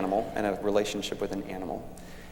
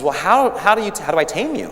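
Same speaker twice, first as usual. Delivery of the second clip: higher, louder, fast-tumbling prince questions; eager little prince; 7 semitones higher, 9 dB louder than usual. Acted. Little prince pressing the fox on how to tame him, high and quick. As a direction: rush the question like a keen child.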